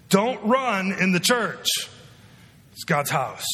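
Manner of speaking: lightly voiced parent drawing out "Don't run"; parent scolding kids. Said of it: "You ever say that to your kids?".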